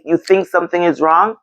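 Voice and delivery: normal talking voice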